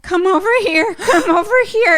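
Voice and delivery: high, loud urgent plea; panicked. Retold or imitated. Scared boy begging his mother to come, high and loud.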